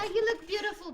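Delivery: teasing. Real, not acted. Teasing compliment.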